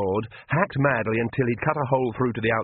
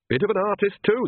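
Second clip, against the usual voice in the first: dry quip; 10 semitones higher than usual. Acted.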